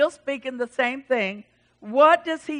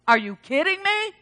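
high-pitched mock disbelief; acted-out astonishment; incredulous; 7 semitones higher than usual. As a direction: ask it high, rising in disbelief. Acts out disbelief at the story.

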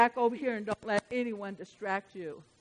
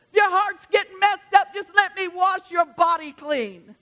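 loud, high, fervent pulpit preaching; preaching at full force; 8 semitones higher, 10 dB louder than usual. Acted. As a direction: rouse the church from the pulpit.

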